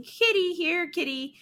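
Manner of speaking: high-pitched kitty call; calling a cat. Retold or imitated. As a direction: imitate someone coaxing a cat over.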